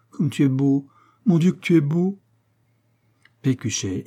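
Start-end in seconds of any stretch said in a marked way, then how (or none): 0.1-2.2 rising higher in wonder; rapt adoration. A narrator's reading. Woman in her lover's arms marvels at him, rapt. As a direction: build from admiration to ecstatic exclamation.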